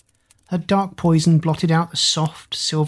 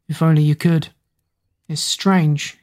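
plain reading voice

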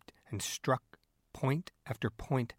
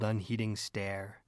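usual speaking voice

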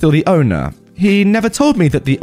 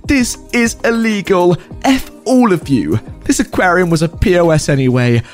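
high, angry parting threats; sputtering foiled villain; 5 semitones higher than usual. Acted. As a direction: spit out final curses, furious. Karen dragged out by security.

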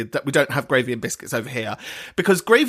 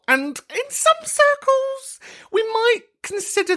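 mock grand.

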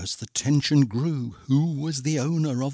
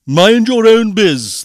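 loud, raised angry retort; shouted storybook comeback; snapped reply; 7 semitones higher, 13 dB louder than usual. A narrator's reading. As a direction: bark it back rudely. Prince snaps back at Cindy.

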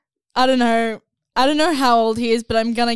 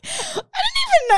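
whiny voice. Retold or imitated. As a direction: wail it like a whiny kid. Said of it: "I was just about to be really whiny".

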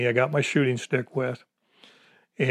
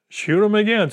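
urgent repeat; 8 semitones higher than usual. Retold or imitated. Echoing Ron's urging.

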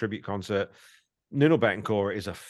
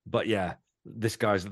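usual speaking voice

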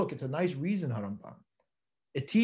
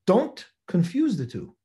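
ordinary talk, nothing marked

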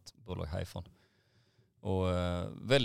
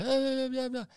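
high, loud imitation of a fuming customer; exasperated high-pitched cursing, imitated; mock angry yell; 8 dB louder than usual. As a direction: protest in a hammed-up high rage. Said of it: "han är så jävla arg fortfarande".

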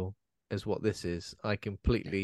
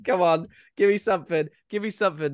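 higher, louder mock begging for more; impatient pleading; 8 semitones higher, 5 dB louder, faster than usual. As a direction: plead like a frustrated viewer who wants something to happen. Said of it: "Where you're just like".

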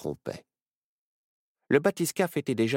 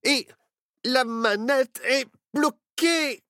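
put-on panicked kid; 5 dB louder, slower than usual. A narrator's reading. Nao panicking, stuck lever.

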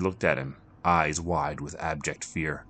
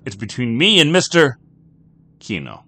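louder and higher, insistent; defiant; 7 semitones higher, 10 dB louder than usual. Acted. Jack insisting to the bartender, loud defiant. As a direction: stand your ground.

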